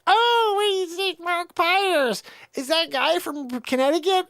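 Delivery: high, slow mock gossip voice; imitating people. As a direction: ham up the nosy onlookers' gasp. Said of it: "They're like".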